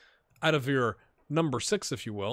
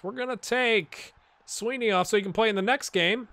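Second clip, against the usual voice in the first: normal talking voice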